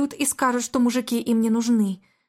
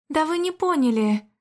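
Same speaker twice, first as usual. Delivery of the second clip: higher, bright interrupting cut-in; cheerful and feisty; 6 semitones higher than usual. A narrator's reading. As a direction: playfully cut him off. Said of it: "Весело так, с задоринкой".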